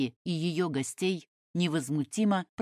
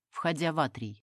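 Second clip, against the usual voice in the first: ordinary delivery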